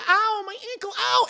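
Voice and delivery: very high cry of pain; mock pained wail. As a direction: wail as if hurt, hammed up. Woman hurt in the crash.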